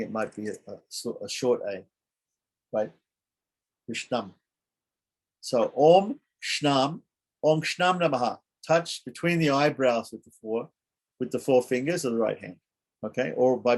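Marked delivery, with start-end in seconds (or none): 5.8-8.4 drawn-out, lifted mantra; slow intoned mantra. Shown as a demonstration. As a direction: stretch each syllable out slowly. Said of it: "recites the mantra".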